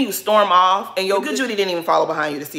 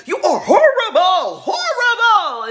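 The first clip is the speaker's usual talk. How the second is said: much higher, louder mocking wail; mock outraged cry; 12 semitones higher, 6 dB louder than usual. Mimicking Robin's outburst at the reunion as a high wail. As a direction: ham up her dramatic outrage, dragging out horrible. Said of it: "And then Robin is like".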